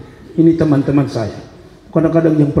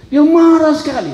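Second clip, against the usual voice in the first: high emphatic telling; 12 semitones higher, 4 dB louder than usual. Retold or imitated. Retelling the leader's great anger.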